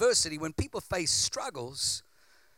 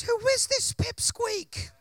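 mocking giant-slayer; 11 semitones higher, 4 dB louder than usual.

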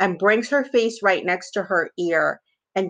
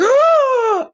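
a sharp, high, loud gasp of shock; imitating a sudden high gasp of disbelief; 5 dB louder than usual. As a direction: gasp like a scandalised lady clutching her pearls. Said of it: "she was like".

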